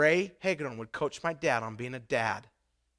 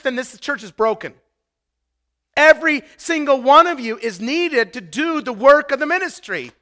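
louder, higher, driving sermon voice; full-force preaching; 9 semitones higher, 13 dB louder than usual. Acted. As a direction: press each point home to the congregation.

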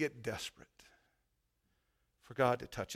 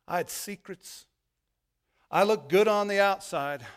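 raised, building preacher's testimony; preaching testimony; 4 semitones higher, 6 dB louder than usual. Acted. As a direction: testify to the church with rising fervour. Preacher testifying with rising force.

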